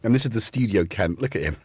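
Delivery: normal talking voice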